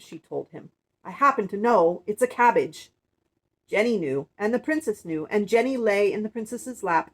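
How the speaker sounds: projected, emphatic read-aloud; storybook reading. Acted. Woman reading of princess, prince and miser, projected storybook narration. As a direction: tell the tale loudly and clearly to children.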